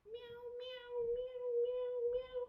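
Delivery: high playful meows; squeaky pretend cat meows; kitty meows. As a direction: meow like a little kitten. Cat pose, kid yoga.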